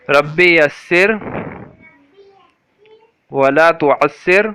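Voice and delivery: slow, drawn-out prayer recitation. Shown as a demonstration. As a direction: recite the dua slowly and evenly. Leads a slow recited dua. (آہستہ، ٹھہر ٹھہر کر دعا کی تلاوت)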